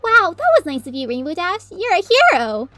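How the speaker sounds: high cheerful praise; cheering. Acted. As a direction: cheer them on happily. Derpy cheers.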